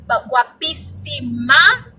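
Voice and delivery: guapísima stretched slowly, every syllable savoured; drawn-out guapísima. Shown as a demonstration. Explaining a Spanish word to viewers, slow drawn-out guapísima. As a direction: lean into guapísima, long and slow. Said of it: "the word guapa is gorgeous".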